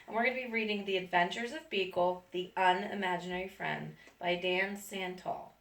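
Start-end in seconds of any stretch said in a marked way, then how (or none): none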